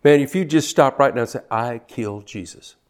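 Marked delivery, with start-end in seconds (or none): 1.5-2.8 a soft spoken confession; hushed confession. Shown as a demonstration. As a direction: say it quietly, like owning it. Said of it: "would you just make that com-, confession".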